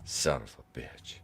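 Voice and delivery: hushed, whispered mock curse; whispered catchphrase. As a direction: whisper it under your breath. Murmurs the running catchphrase aside.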